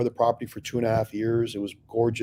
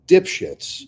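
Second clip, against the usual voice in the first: mock scolding; 7 semitones higher, 7 dB louder than usual. Calling the hedge fund idiots.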